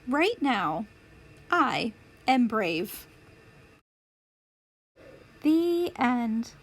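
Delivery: plain reading voice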